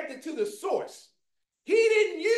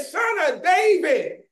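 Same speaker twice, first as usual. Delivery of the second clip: drawn-out, higher imitated holler; voice going up; 4 semitones higher, 4 dB louder, slower than usual. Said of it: "his voice went up".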